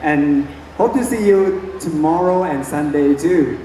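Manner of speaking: ordinary delivery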